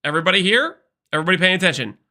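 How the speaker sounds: normal talking voice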